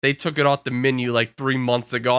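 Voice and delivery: low, subdued retold reply; grave bad news. Cashier breaking menu news to coworker, low grave voice. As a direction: deliver it low, regretfully.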